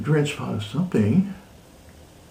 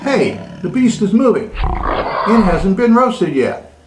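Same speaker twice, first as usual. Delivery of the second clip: louder, higher startled cry at the moving beast; 5 semitones higher, 4 dB louder than usual. Acted. Mister Teacher finds the roast beast alive, startled.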